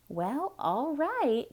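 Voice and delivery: high, slow resigned maid; Amelia agreeing. A narrator's reading. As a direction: draw it out, shrugging along. Amelia voiced in story.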